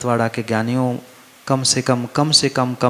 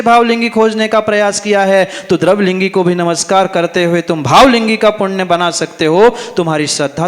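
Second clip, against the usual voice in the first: loud, raised-pitch sermon delivery; impassioned preaching; 8 semitones higher, 5 dB louder than usual. Real, not acted. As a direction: drive the point home to the congregation, high and forceful. Preaches at full force.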